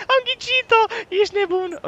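very high, squeaky exclamation; disbelieving squeal. Real, not acted. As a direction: squeal it through amazement. Amazed the guess came true.

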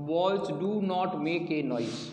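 drawn out, every word spaced; slow classroom dictation; very slow example reading. Shown as a demonstration. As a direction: read the sentence slowly to the class. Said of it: "teacher reads example sentence".

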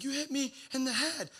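high-pitched imitation of a hurt kid; mock crying complaint. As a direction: whine it like an upset child, hammed-up. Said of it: "he starts crying".

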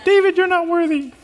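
high, put-on woman's voice; mock lady's voice. A woman's voice mocking the pastor, high and put-on. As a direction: voice a nagging woman in his head. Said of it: "I heard a lady's voice in my head".

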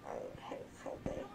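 faint slurred whisper. Real, not acted. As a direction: breathe the words out. Repeated in whisper.

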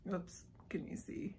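whispered aside. Real, not acted. Vlogger checking the camera view, whispered. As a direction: murmur it almost to yourself.

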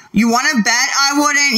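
real indignant challenge, pitched high; high-pitched, combative comeback; heated challenge. Real, not acted. Real quarrel with a viewer.

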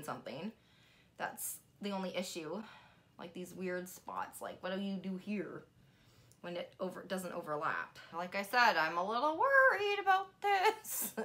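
talking under a facial mask; wearing a sheet mask. Real, not acted. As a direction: face covered, mouth free. Seen 3: Sheet mask covers the face throughout; the mouth opening stays uncovered.

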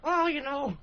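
high, silly puppet voice; squeaky little puppet character; Yogurt Liddy voice. Acted. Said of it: "Liddy, what do you think".

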